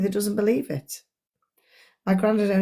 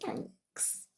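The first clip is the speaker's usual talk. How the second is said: quiet mumbled thanks; 20 dB quieter than usual. Retold or imitated. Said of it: "whereas now she'll go".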